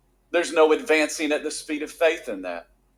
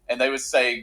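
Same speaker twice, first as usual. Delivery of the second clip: ordinary talk, nothing marked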